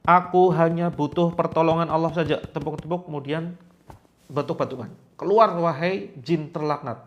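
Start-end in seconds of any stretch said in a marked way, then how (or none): 0.0-2.4 louder, higher firm proclamation; loud defiant affirmation; loud declaration. Shown as a demonstration. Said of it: "Agak keras ngomongnya". 5.2-7.0 louder, higher, slow command to the jinn; stern exorcism command; commanding shout. Shown as a demonstration. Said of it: "Agak keras ngomongnya".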